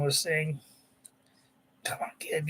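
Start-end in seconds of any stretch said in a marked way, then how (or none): 1.8-2.5 whispered thought. Acted. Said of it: "Sap thinks to himself".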